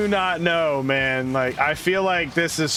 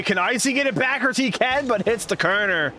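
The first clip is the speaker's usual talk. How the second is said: higher, rising hype call; excited play-by-play; 8 semitones higher than usual. Real, not acted. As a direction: build the hype on the chance. Play-by-play of a close chance.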